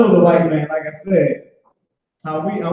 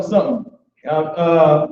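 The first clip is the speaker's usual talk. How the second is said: normal talking voice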